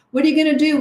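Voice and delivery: put-on pestering child. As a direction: play the nosy kid pressing for an answer. Child pesters parent on outing.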